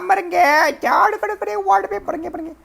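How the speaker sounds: very high, hammed-up babbling squeal; panicked shrieking. Wife panics at the broken idol, mock shrieking. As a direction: shriek in panic, then fade away.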